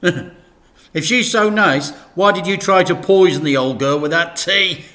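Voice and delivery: mock accusation; teasing Frank. A narrator's reading.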